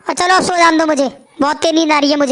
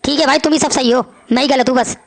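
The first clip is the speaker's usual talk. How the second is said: ordinary talk, nothing marked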